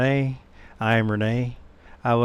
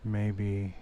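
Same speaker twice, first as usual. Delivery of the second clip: soft, unhurried meditation voice with long pauses; guided meditation; 4 semitones lower, 13 dB quieter than usual. Acted. Meditation teacher leading listeners back to the breath, soft guided-meditation voice. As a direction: guide them gently, much softer than a welcome. Leads a meditation after intro.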